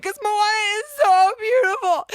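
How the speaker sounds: high, loud tearful wail; loud teary cry that Moana is so beautiful. Retold or imitated. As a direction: wail it through mock sobs. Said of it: "And I'm like".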